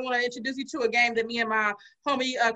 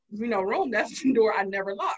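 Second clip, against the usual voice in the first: ordinary delivery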